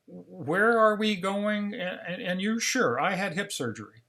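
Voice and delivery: acted confusion, stumbling into the question; confused. Replays his confused memory-loss questions.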